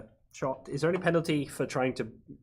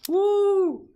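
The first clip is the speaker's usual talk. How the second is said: excited; 9 dB louder than usual. Real, not acted. Cheering the kill.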